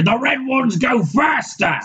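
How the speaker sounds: high, slow goofy orc shout. Acted. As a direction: bellow it like a grinning ork. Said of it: "I used to have a really good orc voice".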